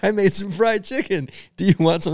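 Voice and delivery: high, eager imitation of the roommate; high mock bro voice. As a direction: pitch up like an eager college kid offering food.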